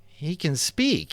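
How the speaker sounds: slow, hesitant reluctant words; slow reluctant confession; slowly. A narrator's reading. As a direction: drag it out, unwilling to tell. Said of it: "he said slowly at last".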